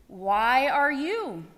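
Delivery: the owl insists, slow and firm; slow insistent question. Acted. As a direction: keep pressing the question slowly.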